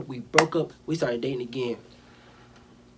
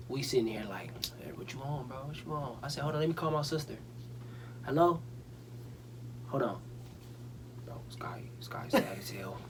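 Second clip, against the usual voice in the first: quiet, secretive murmur; hushed retelling; 10 dB quieter than usual. Retold or imitated. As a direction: keep it hushed and breathy, almost under the breath. Hushed retelling of boys' quiet exchange.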